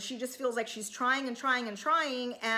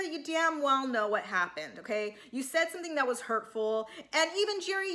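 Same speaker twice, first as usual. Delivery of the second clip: ordinary talk, nothing marked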